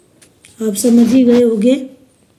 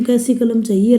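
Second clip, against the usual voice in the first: ordinary delivery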